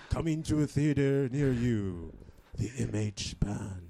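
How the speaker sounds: slow, booming trailer announcer; movie trailer voice. Acted. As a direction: epic trailer narration.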